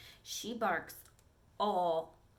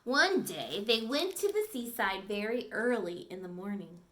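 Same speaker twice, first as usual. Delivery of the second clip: storybook read-aloud, clear and lively; reading to children; 3 semitones higher, 4 dB louder than usual. Acted. Teacher reading a dog story to the class, lively storytelling. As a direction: read it to the class like a picture book.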